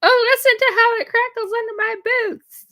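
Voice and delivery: put-on high, delighted little-kid voice; imitating a child. Retelling children crunching frost under their boots, high delighted child voice. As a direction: squeal it like a thrilled child stomping on frost. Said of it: "They're like".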